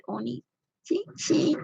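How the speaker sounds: put-on high, slow, soft anime girl voice; cutesy anime voice. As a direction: whimper it like a shy anime girl. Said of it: "and I just said".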